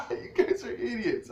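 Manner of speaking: laughing at them while calling them idiots; laughing retort. Retold or imitated. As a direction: scoff with a laugh through the line. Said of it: "I laughed".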